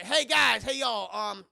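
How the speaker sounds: hammed-up shout; mock call-out. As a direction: call across the room to the crew. Tyrese asks price.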